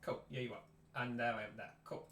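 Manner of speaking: normal talking voice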